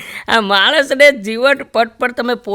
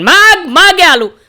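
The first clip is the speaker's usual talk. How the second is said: higher, louder, slow god's offer; booming god; 8 semitones higher, 8 dB louder, slower than usual. Retold or imitated. God offering the man a boon, slow and grand. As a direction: grant the wish grandly.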